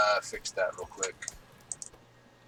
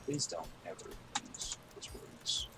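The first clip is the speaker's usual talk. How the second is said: whispered, pained groan of protest; 4 semitones lower, 15 dB quieter than usual. Real, not acted. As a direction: whisper as if the pun hurts. Pained mock reaction to pun.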